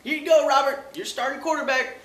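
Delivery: high, loud fake-sweet reassurance; mock Redskins coach. Redskins reassuring Robert Griffin, high fake-sweet voice. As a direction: hand it over like a doting coach. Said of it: "is just like".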